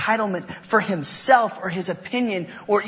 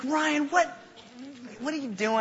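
higher, softened imitation of a mother's questioning; lightly voiced harried mom; 8 semitones higher, 7 dB quieter than usual. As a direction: lightly play a mom checking on her son. Harried mom questions her son.

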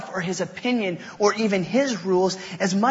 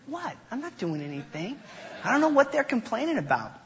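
mock innocent denial; 6 semitones higher, 6 dB quieter, faster than usual. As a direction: play the wrongly accused brother, lightly. Feigning innocence to mother.

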